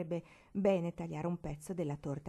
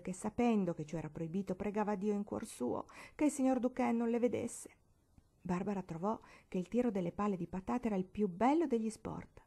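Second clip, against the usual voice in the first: ordinary delivery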